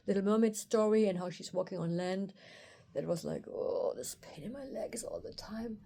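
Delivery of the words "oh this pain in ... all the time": slow, breath-only mock lament; pained whisper. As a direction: whisper it slowly as an aching complaint. Voices her aching legs complaint.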